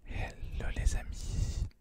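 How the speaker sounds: ordinary talk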